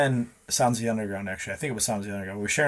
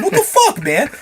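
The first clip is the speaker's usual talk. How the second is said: high, loud disbelieving protest; worked-up, incredulous outburst; retold outrage; 7 dB louder than usual. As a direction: protest loudly, pitch shooting up. Retold outrage at spiked drinks.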